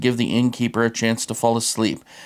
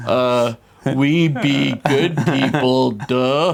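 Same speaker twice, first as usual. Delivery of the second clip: slow hammed-up French accent; much slower than usual. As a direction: drawl it in a goofy French accent. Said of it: "He got a whiff of your French accent".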